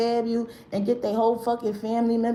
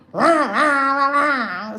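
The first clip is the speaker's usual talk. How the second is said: loud, high demonic rah; demon growl; 5 semitones higher, 5 dB louder, much slower than usual. Retold or imitated. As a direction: shout rah like a possessed voice. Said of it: "Somebody demon sound like that".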